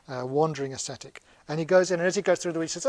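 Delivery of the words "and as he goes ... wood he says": usual speaking voice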